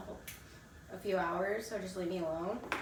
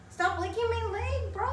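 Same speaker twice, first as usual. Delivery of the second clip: louder, higher scolding yell; high-pitched scolding; 14 semitones higher, 6 dB louder than usual. Real, not acted. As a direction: shoo the dog off your leg. Telling the dog off.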